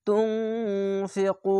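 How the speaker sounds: তুনফিকু with nasal ghunna and the ku stretched; Quranic recitation. Shown as a demonstration. Teacher recites the word for students, drawn out. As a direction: recite তুনফিকু slowly, drawing out each sound. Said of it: "অসাকিন এক আলিফ টেনে পড়বেন".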